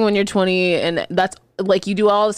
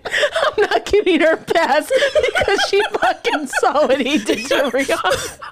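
higher, slow, quoting through laughter; laughing imitation; 9 semitones higher, slower than usual. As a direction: mimic her while laughing. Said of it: "You said".

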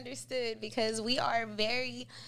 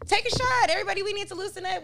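loud, high rallying call, then fast; rally the room to drink; party hype; 8 semitones higher, 6 dB louder, faster than usual. Retold or imitated. As the life of the party, hyping everyone up.

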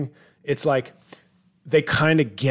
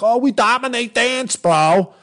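cocky Italian bro brag, pitched higher; mock Italian bro; 9 semitones higher, 4 dB louder than usual. Comic mocking Italians who once ruled dance, cocky bro voice. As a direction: puff up like a proud Italian guy.

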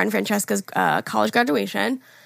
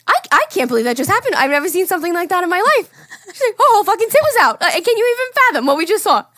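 Fran in shock; 9 semitones higher, 5 dB louder, faster than usual. Retold or imitated. Fran in disbelief on drive home.

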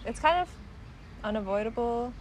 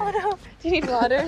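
concerned; 8 semitones higher, 9 dB louder than usual. Real, not acted. Friend choking on bug.

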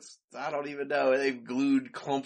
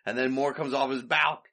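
ordinary delivery

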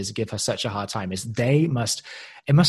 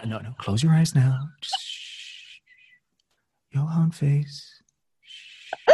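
hushing command; 3 semitones higher, slower than usual. Retold or imitated.

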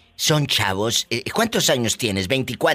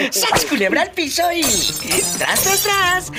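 catchphrase cry; slower than usual. Acted. Host shouting his catchphrase after the husband's story, high and slow. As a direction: stretch each tras up high.

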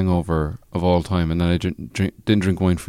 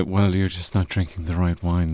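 a hint of a snooty wine snob, softer; quietly superior wine lover; pretentious wine snob; 3 semitones lower, 6 dB quieter than usual. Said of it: "a wine snob would say".

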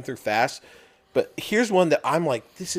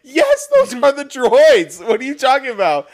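put-on high, loud child protesting; mock indignant daughter; 12 semitones higher, 9 dB louder than usual. Daughter contradicting the Jedi's line, loud mock indignation. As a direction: drag it out like a loudly contradicting kid. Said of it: "the daughter's like".

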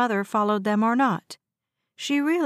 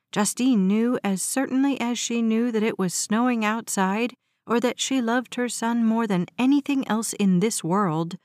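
ordinary delivery

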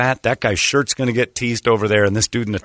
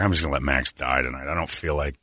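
low, muttered resignation; deadpan quiet; 7 semitones lower, 7 dB quieter than usual. Retold or imitated. Walking away from drunk Max, muttering to himself. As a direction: give up on the friend under your breath.

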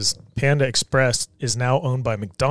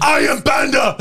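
shouted panda voice; 13 semitones higher, 5 dB louder than usual. Pretend. Acting as a panda.

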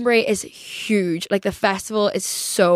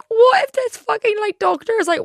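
panicky inner voice; 14 semitones higher, 4 dB louder than usual. Retold or imitated. Imagined panic at doctor.

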